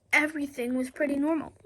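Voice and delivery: plain reading voice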